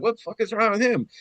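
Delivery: high, quick, incredulous question; retold bafflement. Man out of the water sees friend crying, baffled. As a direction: blurt it out fast in disbelief.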